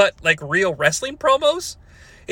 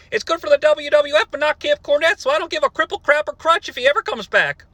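higher, blustery Cornette rant; quoting Cornette; 8 semitones higher than usual. Retold or imitated. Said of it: "He says, and I quote".

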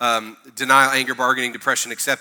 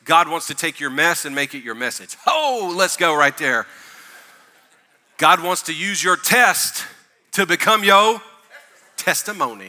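booming motivational speech; 3 semitones higher, 4 dB louder than usual. Shown as a demonstration. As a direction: land the phrase like a preacher. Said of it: "some preachy turn phrases".

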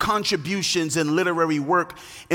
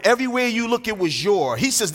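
normal talking voice

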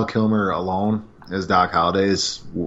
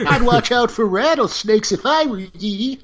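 much higher creaky prospector; cackle-warn like an old miner. Pretend. Continuing prospector bit.

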